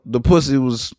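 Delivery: ordinary delivery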